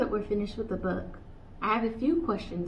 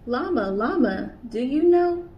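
higher, louder questioning call; curious kangaroo asking; 5 semitones higher, 6 dB louder than usual. Acted. Kanga asking each animal.

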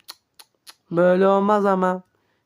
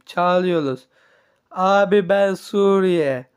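ordinary talk

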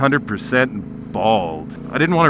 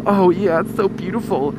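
put-on swooning girl; 6 semitones higher than usual. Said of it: "And all the girls go".

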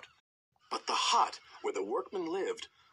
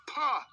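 a sharp, much higher scornful "Pah!"; scornful snort; 12 semitones higher than usual. A narrator's reading. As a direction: spit out a disdainful snort. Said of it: "snorted James".

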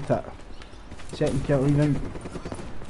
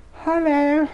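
squeaky falsetto hello, much higher; mock hello. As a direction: sing out a high hello. Calling out in a high voice.

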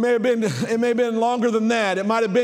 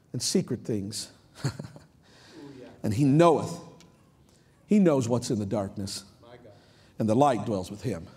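low, soft, reverent preacher's reading; hushed scripture reading; 9 semitones lower, 6 dB quieter than usual. Real, not acted. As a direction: drop right down and let each phrase settle.

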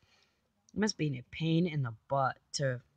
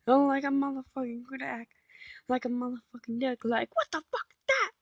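squeaky mock shock; 8 semitones higher than usual. Mocking a startled reaction.

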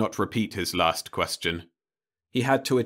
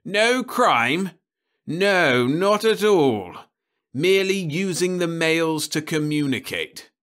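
higher, louder, scornful bureau chief; sarcastic official; 9 semitones higher, 6 dB louder than usual. Acted. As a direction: sneer at the boy's excuse. Crumbine mocks the young man.

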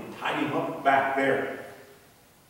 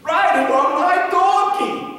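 much higher and louder, bursting with pride; so excited; 11 semitones higher, 7 dB louder than usual. Acted. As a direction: brag to the man beside him. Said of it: "He was so excited".

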